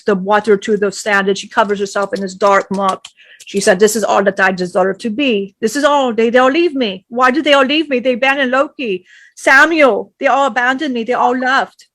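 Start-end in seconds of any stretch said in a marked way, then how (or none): 3.8-11.9 loud anguished lament, mildly acted; despairing Lilith. Lilith alone in the muck, loud mild despair. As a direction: pour out her abandonment loudly.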